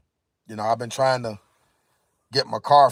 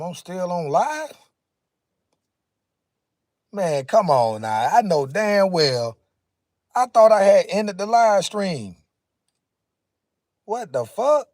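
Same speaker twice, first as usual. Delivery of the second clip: higher and louder, caught off guard; startled, annoyed; 7 semitones higher, 5 dB louder than usual. Real, not acted. Streamer finds the live stream still running, startled and louder. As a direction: blurt it out, shocked you're still live.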